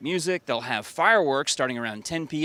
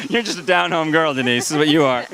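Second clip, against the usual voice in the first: teasing through laughter, louder and higher; laughing; 3 semitones higher, 6 dB louder than usual. Real, not acted. Laughing teasing Denise on air.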